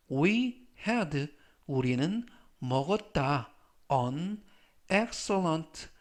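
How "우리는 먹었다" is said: usual speaking voice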